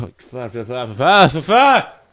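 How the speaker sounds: mounting tongue-tied sputter; frustrated stutter. Real, not acted. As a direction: splutter on the stuck sound until it bursts. Stumbles, mocks own stutter.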